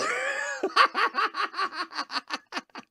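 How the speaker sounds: a sudden high-pitched laugh bursting out. Real, not acted. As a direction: break up laughing at your own joke. Cracks up at his own reading.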